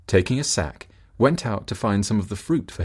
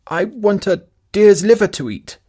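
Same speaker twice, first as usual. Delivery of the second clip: calling back; 12 semitones higher, 8 dB louder than usual. Acted. Wife calls new wish.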